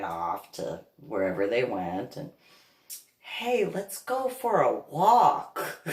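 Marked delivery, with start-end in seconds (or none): 3.2-5.8 high, drawn-out sing-song invitation; mock-cheerful lure; acted cheery coaxing. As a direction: coax brightly, stretching it out slowly. Acted distraction in retold story.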